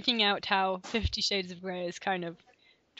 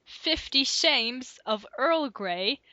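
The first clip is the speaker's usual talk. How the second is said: slow; 3 semitones higher, much slower than usual. Real, not acted. Slow title reading.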